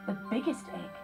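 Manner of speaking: ordinary delivery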